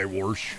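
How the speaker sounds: Warsh with an intruding r, said low and soft; Warsh, Iowa drawl. Shown as a demonstration. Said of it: "They gotta say".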